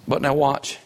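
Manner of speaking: ordinary talk, nothing marked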